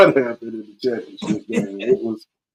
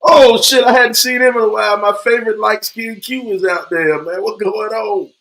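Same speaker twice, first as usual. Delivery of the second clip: high-pitched, thrilled shout-out; excited greeting; 8 semitones higher, 3 dB louder than usual. Real, not acted. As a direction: greet a long-lost favorite with delighted surprise. Delighted greeting of a returning viewer.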